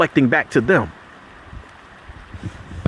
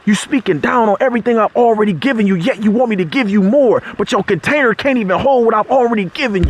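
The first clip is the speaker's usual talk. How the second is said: impassioned preacher pushing up high; fired-up sermon voice; preaching; 5 semitones higher than usual. Acted. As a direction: challenge the congregation, rising to a peak. Preaching gratitude message.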